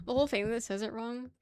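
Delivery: ordinary talk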